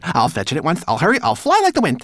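high, rushed, eager servant; flustered cry. A narrator's reading. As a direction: gabble promises loudly to please the master. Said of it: "he cried".

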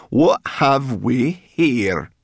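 loud, high, very slow gloat; gloating cry. A narrator's reading. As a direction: stretch it out over the catch. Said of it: "she cried".

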